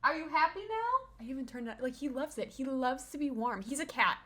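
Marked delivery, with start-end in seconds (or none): 0.0-1.1 much higher and louder coo. Real, not acted. Owner gushing at her dog in his bed, high baby talk. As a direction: gush over the cozy puppy.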